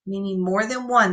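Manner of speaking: usual speaking voice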